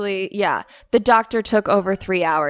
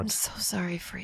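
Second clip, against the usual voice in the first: imitated hushed condolence; mock pitying whisper; whispered sympathy; 13 dB quieter than usual. Repeats doctor's whispered sympathy.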